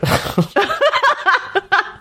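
long high burst of laughter; big laugh. Real, not acted. Laughing at a funny movie line, a long high laugh.

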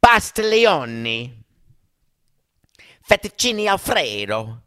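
loud, drawn-out cartoon Italian accent; over-the-top Italian-movie accent; mock Italian accent. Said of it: "speak English with an Italian accent".

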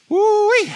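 high, loud preacher's whoop; excited whoop. Real, not acted. Preacher overcome by what God is doing, whooping high. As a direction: let out a big high whoop.

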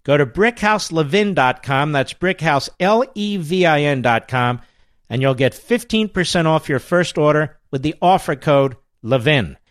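upbeat host-read ad. Acted. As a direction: sell it like a radio ad. Host reads sponsor ad.

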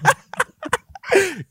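squealing high laugh. Real, not acted. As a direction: burst out in a shrieking laugh. Laughing at own joke.

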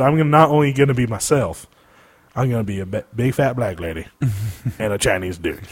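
low, soft, lightly voiced actor impersonation; Eddie Murphy impression. Host quotes the star after his big payday, low impression. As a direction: drop it low and quiet, like a casual celebrity impression. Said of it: "That's my Eddie Murphy impression".

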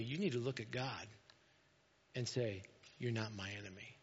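low, very soft counsel; hushed reminder. Retold or imitated. Someone reminding a hurting believer about God, hushed and low. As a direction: quietly bring it home, voice dropped right down.

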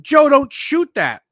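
loud, high-pitched fan scream, drawn out; re-enacted scream from the stands. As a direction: yell it in panic at your own player. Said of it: "that was screaming".

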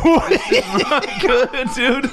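high, laughing despair. Real, not acted. Laughing between hiccups.

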